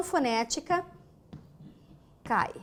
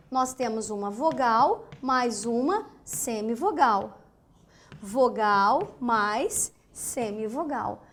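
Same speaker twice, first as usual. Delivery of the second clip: slow emphatic summary; 3 semitones lower, slower than usual. Shown as a demonstration. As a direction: spell out "vogal mais semivogal" slowly. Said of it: "slow summary to students". (resumo lento e enfático para os alunos)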